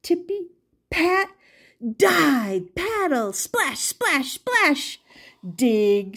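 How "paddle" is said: ordinary delivery, nothing marked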